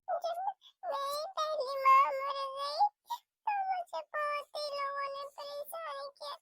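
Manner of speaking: put-on a hurt girl remembering, quietly; girl tells her new mother how people troubled her, soft and sad; sad little girl.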